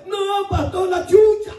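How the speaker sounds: put-on high, wailing old lady; mock wailing woman. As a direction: cry it out like a grieving old woman. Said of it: "woman wails over dead dog". (imitando a la viejita, aguda y llorosa)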